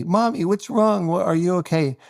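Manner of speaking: put-on high little kid voice asking mommy; worried child. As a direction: play a small boy checking on his mother. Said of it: "a child say".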